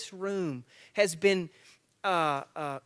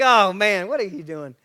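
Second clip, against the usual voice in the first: mock surprise; 2 semitones higher, 11 dB louder than usual. Father reacting to daughter.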